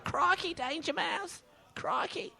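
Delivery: high-pitched British crikey; squeaky British cartoon sidekick; excited British cartoon voice; British hamster impression. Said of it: "in a British accent".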